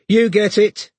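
high, loud woman's voice; high-pitched woman. Acted. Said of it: "said a woman's high-pitched voice".

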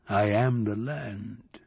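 very slow, soft, grave; slow solemn. Acted. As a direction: declare it like ancient earth. Buffalo man declaring.

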